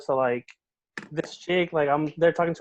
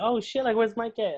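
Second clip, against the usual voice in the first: higher, pleasantly caught off guard; mock-surprised and curious; retold surprise; 7 semitones higher than usual. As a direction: replay a surprised reaction, pitched up. Retells mistaken surprise at coworker's visit.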